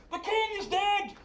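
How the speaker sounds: town crier calling out a death; taken-up shout. Acted. Castle spreads news of the king's death, high shouted cry. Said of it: "the shout taken up".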